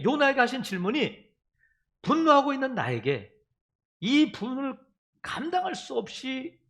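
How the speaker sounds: usual speaking voice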